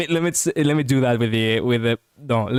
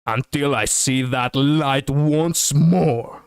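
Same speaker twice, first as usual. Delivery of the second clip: slow, dramatic line reading; voice acting; much slower than usual. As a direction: act the hero line slowly. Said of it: "It sounds like I am trying to voice act this".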